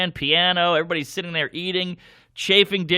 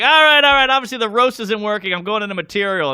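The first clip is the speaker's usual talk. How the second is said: loud stage pivot; 6 semitones higher, 5 dB louder, faster than usual. Retold or imitated. Comic giving up roast.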